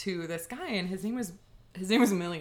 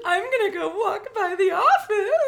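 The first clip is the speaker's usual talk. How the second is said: excited crush; 10 semitones higher, 6 dB louder than usual. Retold or imitated. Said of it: "And I'm like".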